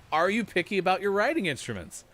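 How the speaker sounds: "writing instruments" asked high and loud; excited host. Shown as a demonstration. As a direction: redo the question with big host energy. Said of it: "Can I get a rewind".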